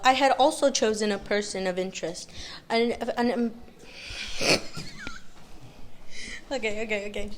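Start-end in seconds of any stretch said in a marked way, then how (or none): 4.1-6.5 high airy giggle fit; helpless silent giggles; breathy laughter. Real, not acted.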